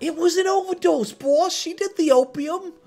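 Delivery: high, frantic, pleading excuses; panicked excuses. Acted. Henchman pleading with the boss about the overdose, high and frantic. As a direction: talk your way out in a panic.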